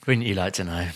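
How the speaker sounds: low, soft, smug tease; teasing. Pretend. Teasing his friend who asks when it starts, low smug tease.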